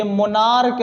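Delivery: मोनार्क ending in a hard "k". Shown as a demonstration. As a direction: close मोनार्क on a k, never "ch". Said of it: "मोनार्च नहीं".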